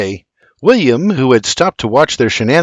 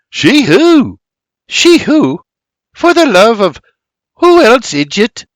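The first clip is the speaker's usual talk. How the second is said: high, louder back-and-forth; exasperated; 10 semitones higher, 5 dB louder than usual. Acted. Siblings bicker over who missed him, exasperated. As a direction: press the question with mounting impatience. Sister teases brother, both exasperated.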